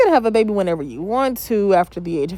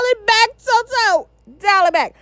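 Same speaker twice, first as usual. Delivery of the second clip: mock calling out; 12 semitones higher than usual. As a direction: call her back in a high, stretched voice. Mock-calling the woman to back off.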